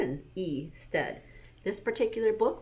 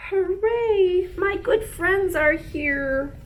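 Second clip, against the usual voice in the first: much higher, louder happy cry; joyful cheer; 11 semitones higher, 7 dB louder, much slower than usual. Acted. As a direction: cheer with delight at visitors. Amos delighted to see friends.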